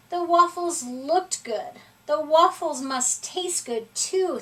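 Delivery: plain reading voice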